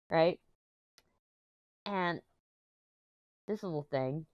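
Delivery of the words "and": ordinary delivery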